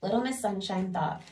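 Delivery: ordinary delivery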